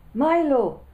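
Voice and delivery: high loud cry of a name, far-off voice on a phone line; cried out. A narrator's reading. Friend on a crackly phone, crying out loud. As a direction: call his name up high and loud, spooky. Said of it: "he cried".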